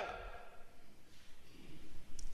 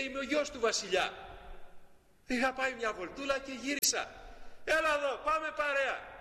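cheerful little prince; 7 semitones higher than usual. Acted. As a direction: call a friend to come along. Prince invites the child in. (πρόσχαρος μικρός πρίγκιπας)

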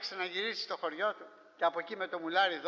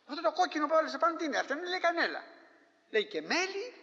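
fast, higher, eager asking; eager questions; 7 semitones higher, faster than usual. Retold or imitated. Villager asks eagerly about the recipe. (γρήγορα, ψηλά, με ανυπομονησία)

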